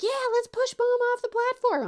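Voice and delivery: put-on high, loud, sassy child voice; gleeful kid. Daughter urging Dad to push Mom, gleeful and high. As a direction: pipe up like a mischievous kid egging Dad on.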